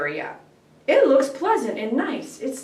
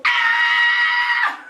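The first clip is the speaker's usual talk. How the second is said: piercing high shouted scream, much higher; imitated dog scream. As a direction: scream like Blue at top pitch. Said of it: "at the highest pitch of her voice".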